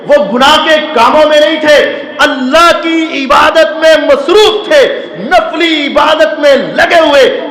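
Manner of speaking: preacher shouting his lesson, pitch climbing; fiery preaching. Acted. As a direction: drive the point home to the congregation. Preacher rising to fervent climax.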